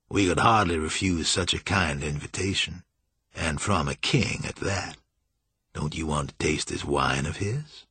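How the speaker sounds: low, softened voice of a sly master; sly, urbane Julian. A narrator's reading.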